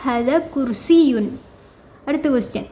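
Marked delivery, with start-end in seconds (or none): 0.0-1.3 slow, careful question and answer; slow teacher reading; slow model dialogue. Shown as a demonstration. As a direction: model the exchange for students. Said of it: "ഇതൊക്കെ ഇങ്ങനെ തന്നെ മനസ്സിലാക്കി വെക്കുക".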